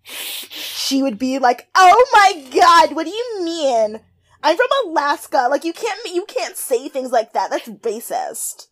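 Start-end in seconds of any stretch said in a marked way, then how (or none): 1.7-8.7 high, gasping mock outrage; sky-high mock Alaskan girl protesting; mock valley girl. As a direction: play the shocked airhead. Imitating Hannah as valley girl.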